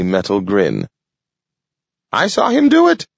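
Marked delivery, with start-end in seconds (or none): 2.1-3.1 high piercing squirrel scream. Acted. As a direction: shriek it out at the top. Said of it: "screamed Fatso".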